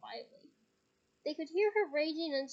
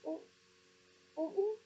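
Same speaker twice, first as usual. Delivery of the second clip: uncertain; 5 semitones higher than usual. Acted. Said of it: "he added uncertainly".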